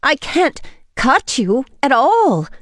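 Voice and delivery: high, slow, panicky plea; pleading in fear, drawn out. A narrator's reading. Frightened woman refuses to cut vampire, panicky. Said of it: "I said, panicky".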